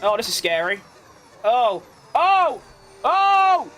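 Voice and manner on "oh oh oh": rising yelps, higher and louder each time; real fright as the car nearly hits; startled yelps. Real, not acted. Racing driver yelps in real fright at a near crash.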